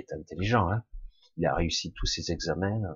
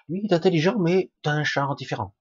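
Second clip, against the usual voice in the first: "Lui est intelligent" much higher and louder, gushing; mock admiring; 11 semitones higher, 4 dB louder than usual. Imitating people praising the clever one, high mock admiration. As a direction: gush "Lui est intelligent" like an impressed onlooker.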